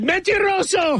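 high, slow Spanish "Mentiroso"; mom's retort. Retold or imitated. Co-host quoting the mom calling him a liar, high and slow. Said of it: "And then she said".

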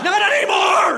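very high, loud battle cry; over-the-top rescuer's yell; heroic eagle shout. Acted. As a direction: roar in as the avenging hero. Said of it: "In comes a bald eagle".

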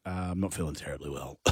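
weak, fast fake-ill mumble; fake sick voice. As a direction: play sick on the phone. Worker faking illness to boss.

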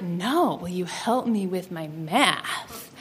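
low, soft, slow come-on; suggestive boy. Retold or imitated. Caleb repeating his pickup line to Annie, suggestive. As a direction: imitate a boy pressing his innuendo quietly.

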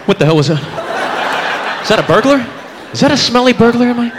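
rising alarmed questions; guy woken by his own fart; startled panic. Retold or imitated. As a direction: wake up alarmed, pitch climbing. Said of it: "Just kinda".

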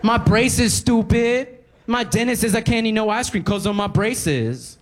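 put-on high, exasperated girl's voice. Cashier girl scolding the customer, high and exasperated. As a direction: sound fed up and bratty. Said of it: "looks up at me angrily and goes".

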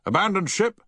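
loud, high shouted alarm. A narrator's reading. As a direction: shout it to the whole ship. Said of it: "shouted Havens".

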